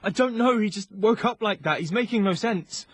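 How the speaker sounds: high, loud, frightened explaining; panicked. Acted. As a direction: blurt it out in a panic. Panicked soldier explains to nurse.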